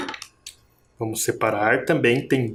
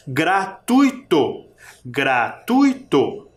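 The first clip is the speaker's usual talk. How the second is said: slow Gratuito in three pieces, gra, tui, to, TUI strongest; sílaba por sílaba; much slower than usual. Shown as a demonstration. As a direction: break Gratuito apart, pushing the TUI. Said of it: "Vamos separar?". (Gratuito devagar, separando gra-tui-to)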